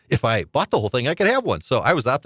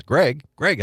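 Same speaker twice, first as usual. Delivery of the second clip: called out higher and louder; calling out; 8 semitones higher, 3 dB louder than usual. Retold or imitated. Waitress calling out to the busboy by name, higher and louder. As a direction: call across the room to get his attention.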